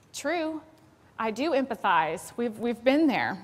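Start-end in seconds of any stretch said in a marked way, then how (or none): none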